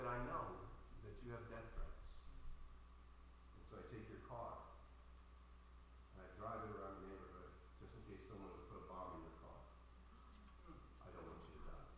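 very soft, sinking low as it goes; hushed, tender confession. Retold or imitated. Wife telling her husband why she takes his car, hushed confession. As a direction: retell the wife's words in a low hush.

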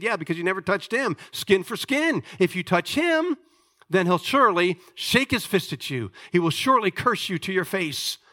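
high, insistent taunt; preacher voicing Satan's defiant challenge; acted challenge. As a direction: throw down a dare, building in pitch. Satan challenging God in retelling.

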